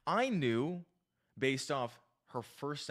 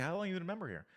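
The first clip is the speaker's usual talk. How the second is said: higher-pitched friendly question; disarming opener; 5 semitones higher, 3 dB quieter, much faster than usual. Retold or imitated. Retelling his opener to a woman at the gym, disarming. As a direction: ask it lightly to disarm her. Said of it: "disarm them with the words we say and how we say them".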